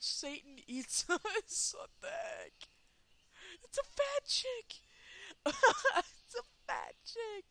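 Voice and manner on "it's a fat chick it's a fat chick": much higher, louder shrieking laugh; shocked shout. Real, not acted. Gamer seeing a huge creature appear, shrieking. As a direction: shriek it out in disbelief.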